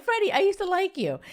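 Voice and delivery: high, bright mock confession to Freddie; mock swooning quote. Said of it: "I'm like".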